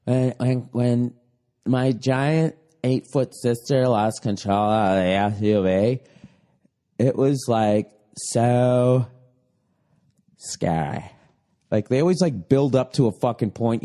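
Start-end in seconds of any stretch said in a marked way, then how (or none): 0.0-11.0 low, slow, drawn-out mock testimony; dopey slow mimicry, trailing low; imitating a whiny interviewee. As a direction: draw out every word, mocking their drama. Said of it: "standing there going like".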